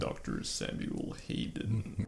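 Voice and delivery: soft slow robotic whisper; Hayden voice. Retold or imitated. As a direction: breathe the name like a machine. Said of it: "And it lingered a bit".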